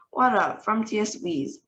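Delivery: usual speaking voice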